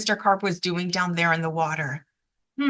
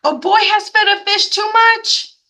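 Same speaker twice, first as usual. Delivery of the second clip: higher, louder urgent report; urgent radio call; 11 semitones higher, 6 dB louder than usual. Acted. Policeman on the radio to firemen, urgent loud call. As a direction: report it like an emergency. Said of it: "he said".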